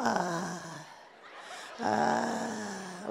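"ah" grave y muy suave; tono bajo. Shown as a demonstration. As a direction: empieza el gemido muy grave y bajito. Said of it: "Uno agarra tono bajo primero". ("ah" grave y casi susurrado)

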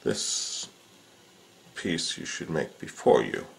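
ordinary talk

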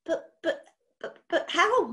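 stumbling over the repeated buts; startled boy stammering. Acted. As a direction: trip over the words, lost for speech. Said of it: "he stammered".

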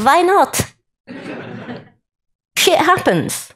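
high shrugging Yiddish why not; Yiddish accent. Shown as a demonstration. Heaven answering back in a Yiddish accent. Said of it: "I have to do the Yiddish accent".

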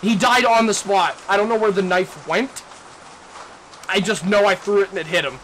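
loud, high, thrilled disbelief; hyped up after a lucky hit; excited. Real, not acted. Excited after killing intruder.

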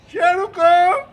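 loud rising call of the name; loud dog call. Real, not acted. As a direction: call Cheru over loudly. Calling dog loudly.

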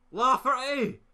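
high loud yell of a name; excited shout. Real, not acted. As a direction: yell his name at the chance. Chance on goal.

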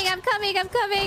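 high, loud hurried call; urgent shout. Real, not acted. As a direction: yell that you're on your way. Rushing to help in a fight.

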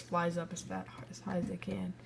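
breathy whispered aside. Real, not acted. Player muttering about the drawings, whispered aside. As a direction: whisper it to yourself.

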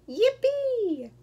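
long high cheer; high, drawn-out yippee falling away; excited cry. A narrator's reading. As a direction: cry out in sheer delight. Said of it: "cried Froggy".

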